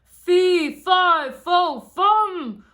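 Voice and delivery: very loud, high roar; furious giant roaring after Jack; angry giant's roar. A narrator's reading. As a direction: bellow it while chasing down. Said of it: "roared the angry giant".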